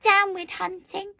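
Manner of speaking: high, loud hooted slogan; high storybook hoot. A narrator's reading. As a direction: hoot it out like a protest cry. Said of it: "For he hooted".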